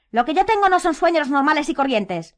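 high, loud retort; angry shout. A narrator's reading. Harry rises to face Hermione, shouting. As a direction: shout back in protest. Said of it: "le gritó Harry levantándose".

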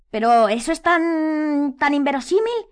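high, loud, drawn-out plea; desperate insistence. A narrator's reading. Hermione argues against Harry's theory, desperate. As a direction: insist desperately, stretching the first words. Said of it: "insistió ella desesperada".

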